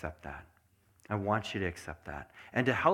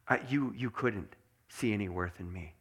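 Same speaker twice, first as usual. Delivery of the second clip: normal talking voice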